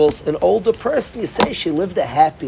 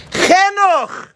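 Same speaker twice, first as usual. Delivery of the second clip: high, hammed-up yell of the word; mock scream; 14 semitones higher, 4 dB louder than usual. Young man yelling at the deaf-seeming Rebbe, high mock scream. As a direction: shout it into an old man's ear. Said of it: "He screamed to the Kloisenberger".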